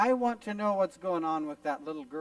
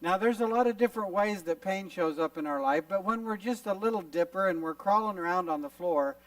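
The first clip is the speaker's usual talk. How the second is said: ordinary delivery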